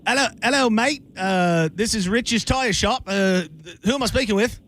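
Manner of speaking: high, cartoonish Australian shop boss; Australian accent. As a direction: answer the phone as a chirpy high Australian. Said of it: "I was thinking Australian".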